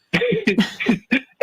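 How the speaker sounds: bright high burst of laughter. Real, not acted. Guest laughing at the host's remark, high laugh.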